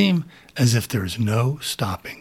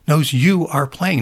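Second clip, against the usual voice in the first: usual speaking voice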